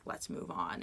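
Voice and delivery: unvoiced, hushed let's move on; telling the old lady quietly to move on; whispered. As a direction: breathe it out softly. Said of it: "I was just like".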